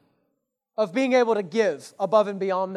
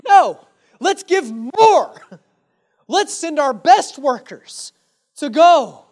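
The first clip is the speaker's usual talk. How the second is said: bold eager reply, much louder, higher and slow; eager generosity; 4 semitones higher, 9 dB louder, much slower than usual. Retold or imitated. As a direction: answer the doubters with joyful defiance. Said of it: "What do they do?".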